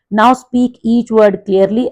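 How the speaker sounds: reading the instruction out slowly and clearly; teacher reads the book line aloud to the class, slow and careful; slow textbook read. Acted. Class ko instruction dheere dheere padhkar sunati teacher, saaf aur dheemi.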